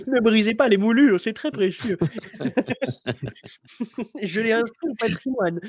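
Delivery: high, loud, prissy and laughing; fussy heritage guardian. Pretend. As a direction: squeal like a precious curator protecting mouldings. Player mocks fussy heritage guardian.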